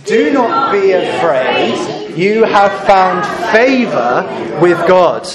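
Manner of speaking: slow, drawn-out group reading; reading aloud together. Acted. Leading the congregation in reading the angel's words, slow unison. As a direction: read it slowly in unison, word by word. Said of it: "can you read this bit with me".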